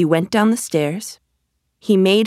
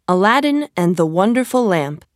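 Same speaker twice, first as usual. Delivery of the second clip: usual speaking voice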